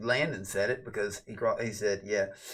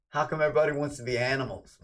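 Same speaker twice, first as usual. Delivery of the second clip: ordinary delivery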